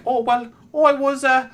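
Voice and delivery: lightly mocking high kid voice; little kid voice. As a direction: mimic a ten-year-old boy telling his story. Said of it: "And he's like".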